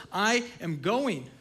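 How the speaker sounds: slow, firm declaration; each word spaced out with resolve; resolute. Retold or imitated. Paul insisting to his friends, slow and firm. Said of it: "Paul says".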